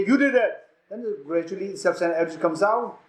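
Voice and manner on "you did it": quick mock accusation, pitched up; accusing. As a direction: point the blame in an acted quarrel. Acted quarrel, accusation repeated.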